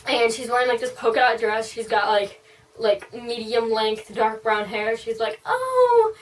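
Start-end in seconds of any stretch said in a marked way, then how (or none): 5.4-6.1 very high, loud, gushing greeting; overly cheery. Retold or imitated. Hairdresser greeting a new client, overly cheery. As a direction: squeal a hello in an overly cheery put-on voice. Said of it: "she seemed overly cheery".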